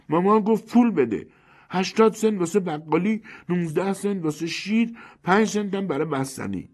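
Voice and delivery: put-on very high, piercing child's voice; screeching little girl. A narrator's reading. Little girl asking Finch for money for Mama, screeching. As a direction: squeal it out like a small child. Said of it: "با صدای گوشخراش جیغ کشید".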